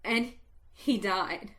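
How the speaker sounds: ordinary delivery, nothing marked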